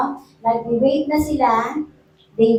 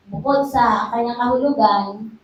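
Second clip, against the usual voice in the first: ordinary talk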